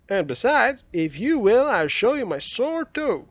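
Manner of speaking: put-on young Tom wheedling his friend; boy Tom. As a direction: coax him like a scheming boy. Narrator voices Tom.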